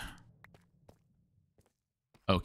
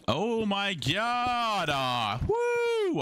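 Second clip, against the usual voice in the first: excited; 9 semitones higher, much slower than usual. Real, not acted. Three hundred subs.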